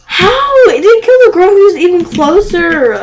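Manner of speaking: high, loud astonished outburst; baffled by the game; surprised. Real, not acted.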